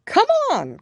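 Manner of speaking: very high, loud call; a creature's urgent yell; urgent cry. A narrator's reading. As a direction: hurry them along with a shout. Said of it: "cried the Gryphon".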